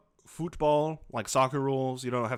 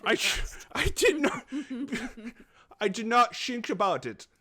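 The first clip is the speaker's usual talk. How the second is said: silly high voice; 10 semitones higher than usual. Acted. Keeps silly voice, laughing.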